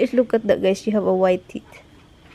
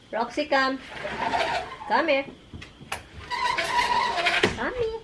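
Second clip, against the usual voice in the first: rising higher call to the dog; 9 semitones higher, 3 dB quieter than usual. Real, not acted. Owner calling Roxy the dog to come, rising call.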